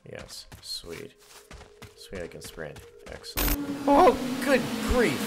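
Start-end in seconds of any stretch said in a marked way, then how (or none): 3.1-5.2 startled. Real, not acted. Startled by a sudden boulder.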